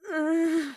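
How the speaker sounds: high, strained nervous whine; a tiny panicked noise; flustered whimper. Acted. Yoshiko squeaking in panic when Daya calls it a date.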